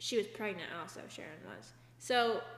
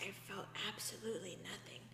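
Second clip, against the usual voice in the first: creepy woman voice; 8 dB quieter than usual. Retold or imitated. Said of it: "she talks like a creepy woman".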